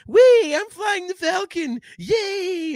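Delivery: mock a giddy kid on the ride; nitwit kid. Kid on the ride squealing at the controls, high and giddy. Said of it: "some nitwit kid on vacation".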